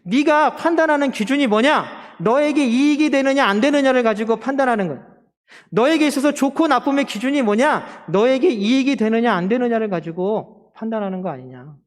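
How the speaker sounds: God's rebuke. Acted. Preacher as God questioning Jonah's standards, impassioned challenge. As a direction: press the accusation question after question. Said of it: "하나님 말씀하시는 거야".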